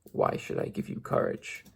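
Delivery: breathy near-whisper growl. A narrator's reading. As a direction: hiss it low from the flames. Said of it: "low, quiet growl".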